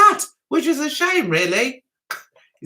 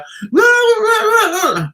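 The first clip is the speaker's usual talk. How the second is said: loud crying laugh; 9 semitones higher, 6 dB louder than usual. Real, not acted. Laughing at her own story.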